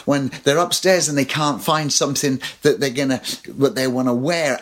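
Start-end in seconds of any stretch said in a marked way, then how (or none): none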